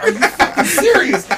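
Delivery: high, loud laughing disbelief; retold outburst, incredulous and laughing; losing it. Ticketless fan to security, losing it. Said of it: "I'm losing it".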